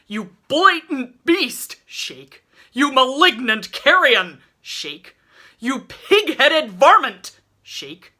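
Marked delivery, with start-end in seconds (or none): 0.1-1.8 furious high, loud, slow insult; enraged bellowed insult; abusive insult. A narrator's reading. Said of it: "a lurid assortment of abusive epithets". 2.7-4.4 enraged bellowed insult; abusive insult. A narrator's reading. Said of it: "a lurid assortment of abusive epithets". 5.6-7.4 furious high, loud, slow insult; enraged bellowed insult; abusive insult. A narrator's reading. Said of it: "a lurid assortment of abusive epithets".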